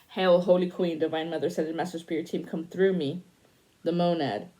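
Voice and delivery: loud, ringing invocation to the Holy Queen; fervent prayer. Acted. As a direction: call on the spirits with full force. Invokes divine mother in prayer.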